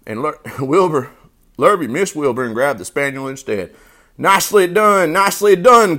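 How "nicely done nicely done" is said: high, loud goose cheer; cheering goose cry. A narrator's reading. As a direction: applaud as an excited goose. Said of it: "cried the goose".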